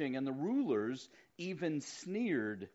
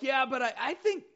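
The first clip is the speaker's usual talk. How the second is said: eager reply; 10 semitones higher, 6 dB louder than usual. Retold or imitated. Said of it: "I'm like".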